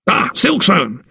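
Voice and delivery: high-pitched cry of greeting in pain; pained, high exclamation. A narrator's reading. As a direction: call out the name urgently, pitch jumping up. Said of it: "he cried, even before Mason".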